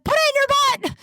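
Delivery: high, loud shriek as an insistent grandma; grandma yelling. Retold or imitated. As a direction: imitate grandma hollering an order. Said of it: "my grandma was ... yelling at me".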